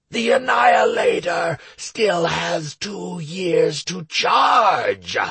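slow booming demon voice; demon lord. Acted. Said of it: "boomed the demon".

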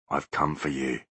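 low and soft, a voice from beyond; low ghostly voice. A narrator's reading. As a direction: menace him quietly from the dark. Ghostly Butler voice.

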